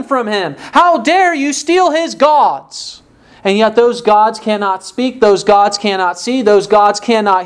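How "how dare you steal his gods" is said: higher and slow, drawn-out outrage; mock indignation. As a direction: mimic an offended owner, slow and high. Mimics Micah's indignation.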